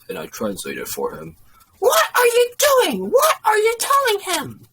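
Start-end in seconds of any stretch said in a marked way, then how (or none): 1.8-4.7 high, loud shriek; outraged mother. A narrator's reading. Mother catches waiter translating to boss, shrieking.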